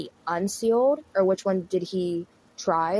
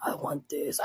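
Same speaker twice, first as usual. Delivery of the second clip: a breathy, unvoiced devilish whisper, softer; demonic whisper; 5 semitones lower, 8 dB quieter, slower than usual. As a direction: hiss it like a possessed doll. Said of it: "this demonic shit".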